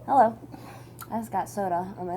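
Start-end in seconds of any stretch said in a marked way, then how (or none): none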